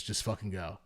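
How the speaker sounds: low, soft send-off; retold cop's dismissal. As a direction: quietly let them off the hook. Cop quietly letting him off.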